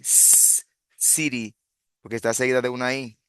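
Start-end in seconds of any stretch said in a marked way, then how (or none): 0.0-0.6 "s-" as a sustained voiceless hiss; long isolated sibilant for "s-"; soft C sound. Shown as a demonstration. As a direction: hold the "s-" with no vowel. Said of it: "la C suena así".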